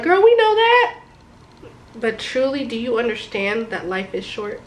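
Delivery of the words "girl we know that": put-on high, loud sassy girl voice; mock viewer retort. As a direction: play the eye-rolling viewer, way up high. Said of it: "very, like".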